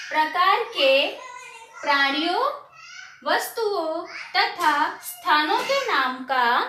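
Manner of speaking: slow, drawn-out phrases with pauses; slow repeat-after-me. Shown as a demonstration. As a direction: lead the class through it phrase by phrase. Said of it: "धीरे धीरे उसे बोलती हूँ". (धीरे-धीरे, साथ दोहराने के लिए)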